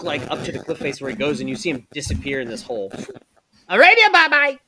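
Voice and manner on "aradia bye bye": high, loud squawking parrot voice. Acted. The pet parrot squawking at the player, high parrot voice.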